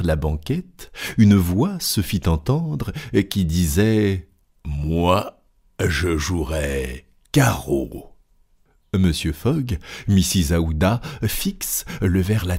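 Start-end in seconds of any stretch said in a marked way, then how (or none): 4.7-8.2 low, slow, menacing colonel's voice cutting in; gruff colonel. Acted. Colonel cuts in on the card game, low and slow. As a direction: drop low and drawl each word.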